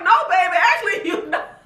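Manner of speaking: mock-tender talking back to Tanya; laughing. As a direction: laugh as you correct her. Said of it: "I was just like".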